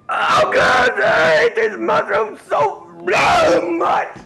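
high, anguished mock sobbing cry; hammed-up tearful meltdown; hysterical wailing. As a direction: wail it in melodramatic despair. Wailing hatred of the mushrooms.

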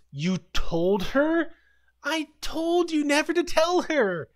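a high, put-on voice of betrayal; squeaky mock betrayed teen; upset accusation. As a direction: accuse him, voice climbing. Bobby upset at Mac.

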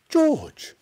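very high, loud call; shouting George's name. Acted. Mister Cranky calling to George, very high and loud. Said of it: "Mister Cranky shouted".

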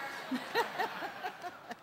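a high, voiceless laugh; airy laugh. Real, not acted. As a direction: laugh freely at the joke. Laughing after her joke.

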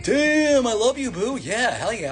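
high, gushing cartoon suitor; hammed-up lovestruck outburst; mock smitten excitement. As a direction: start way up high and fall as you gush. Duckman suddenly smitten with her.

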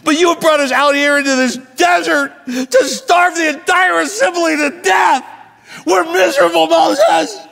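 shrieking, hammed-up accusation; whining complaint. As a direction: wail at Moses at full pitch and volume. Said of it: "I kinda have to put tone to it".

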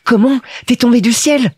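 put-on high, loud little-boy exclamation; astonished Petit Prince. A narrator's reading. Little prince hears the pilot fell from the sky, astonished. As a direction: cry out in surprise like a small child. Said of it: "Alors il s'écria".

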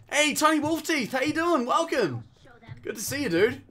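high, cheery hail; excited welcome. Real, not acted. Welcomes a viewer to the stream.

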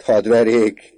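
loud, high exclamation; hearty shout. A narrator's reading. Father joking about his son's beatings, hearty shout. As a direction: call it out heartily. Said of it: "kiáltotta".

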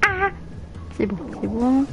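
normal talking voice

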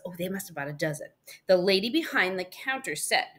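plain reading voice

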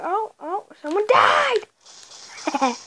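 high, drawn-out shout; thrilled at the kill; excited. Real, not acted. Excited in game.

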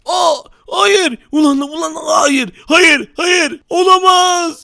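high desperate shouts of no; panicked screaming. Acted. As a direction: scream as the machine transforms you. Dönüşüm makinesinde çaresiz haykırış. (çaresizce bağırarak)

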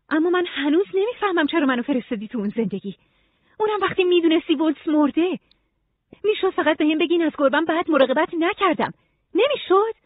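high-pitched, aggrieved protest; frustrated Nora. Acted. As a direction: demand an explanation, pitch well up. Voices Nora protesting in dialogue. (معترض و دلخور، با صدای زیر)

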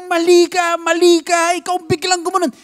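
angry shouting. Retold or imitated. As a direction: hammer the blame on him. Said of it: "galit na galit ako".

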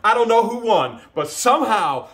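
high, excited final tally; elated, high-pitched declaration; triumphant announcement. Real, not acted. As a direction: announce the result like a big win. Announcing final count and possible win.

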